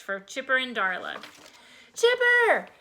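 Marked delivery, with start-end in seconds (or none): 1.9-2.7 high, loud calls for lost friends; piercing search calls; shouting over storm. A narrator's reading. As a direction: yell names above wind and rain. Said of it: "he shouted above the wind and rain".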